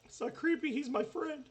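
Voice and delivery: very high cutesy toy voice; squeaky doll voice. Pretend.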